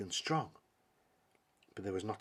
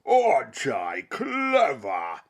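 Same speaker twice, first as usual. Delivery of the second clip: slow, drawn-out giant bellow, much louder; bellowing monster; 3 semitones higher, 10 dB louder, much slower than usual. Acted. Fossifu boasting after emptying the lake, slow bellow. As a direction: gloat it out slowly like a giant. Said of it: "he bellowed".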